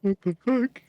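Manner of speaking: high, loud disbelief; shocked. Real, not acted. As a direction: blurt it out in shock. Shocked at a friend's words.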